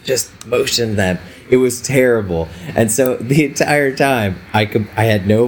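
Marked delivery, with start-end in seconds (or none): 0.0-1.2 mock strained whisper with barely any voice; hoarse whisper. Photographer retelling how she croaked orders at her second shooter. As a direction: rasp it out like you've lost your voice.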